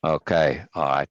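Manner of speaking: ordinary delivery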